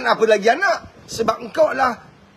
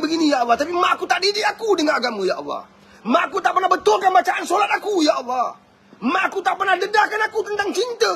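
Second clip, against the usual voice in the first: anguished prayer in a raised voice, rising to near shouts; pleading child's prayer; 6 semitones higher than usual. Acted. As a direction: cry out like a child begging for mercy. Child pleading to God from hell.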